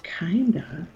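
soft unvoiced disbelief; whispered echo. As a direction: breathe the word back in doubt. Echoing his answer in disbelief.

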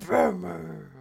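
joking superhero growl; gruff mock-heroic rasp; Batman. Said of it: "a very Batman voice".

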